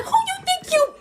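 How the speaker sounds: high-pitched mock mom screech; outraged mom. Mom scolds child for talking back, outraged. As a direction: imitate a mother shocked by back talk.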